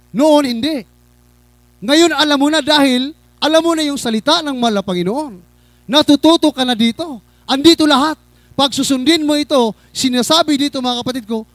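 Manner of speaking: high, loud pulpit delivery; forceful preaching. Real, not acted. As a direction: drive each point home at full voice. (malakas at masidhing pangangaral)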